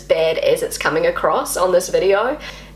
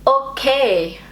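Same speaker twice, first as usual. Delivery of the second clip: higher, imitated German "Okay"; German-style okay; 6 semitones higher than usual. Shown as a demonstration. Learner checking if it sounds better, German accent. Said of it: "Does that sound better?".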